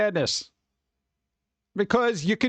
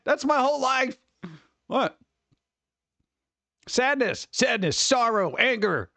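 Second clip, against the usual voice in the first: ordinary delivery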